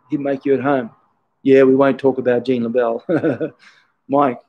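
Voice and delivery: ordinary delivery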